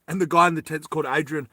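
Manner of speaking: ordinary talk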